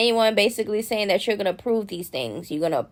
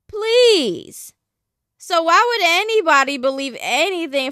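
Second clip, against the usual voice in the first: high, loud, scornful "please"; dismissive scoff; 7 semitones higher, 4 dB louder than usual. Real, not acted. Host scoffing at the fan page's claims, dismissive. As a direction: scoff it away with disbelief.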